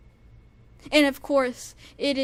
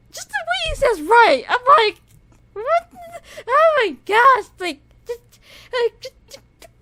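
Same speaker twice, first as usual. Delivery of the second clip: much higher and louder, squealing in disbelief; fangirl squeal; 11 semitones higher, 12 dB louder than usual. Real, not acted. Fan losing it over how the singer says a word. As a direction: shriek with fan delight.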